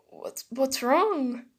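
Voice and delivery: slow, stammered worried question; about to cry. Acted. Boy asking yelling classmate, about to cry. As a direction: falter as if tears are coming. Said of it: "about to cry".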